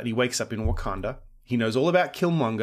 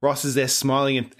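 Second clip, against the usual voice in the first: ordinary talk, nothing marked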